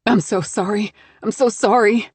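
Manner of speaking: tearful, rising apology; crying. Acted. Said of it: "Lucy cries over and over".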